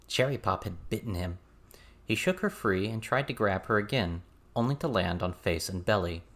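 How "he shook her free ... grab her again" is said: usual speaking voice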